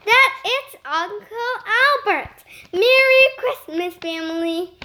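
jovial booming uncle; booming greeting. Acted. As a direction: boom it across the room. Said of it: "Albert boomed".

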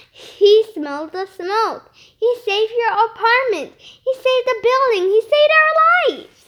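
building from firm to loud, high cry; urgent defence. A narrator's reading. Nick defending Marvin to Missus Finnegan, rising urgent protest.

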